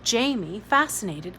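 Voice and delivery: ordinary delivery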